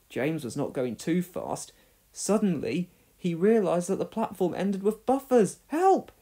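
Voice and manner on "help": high frightened cry for help; gasped cry. Acted. James facing the buffers, frightened gasp. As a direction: gasp in terror. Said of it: "he gasped".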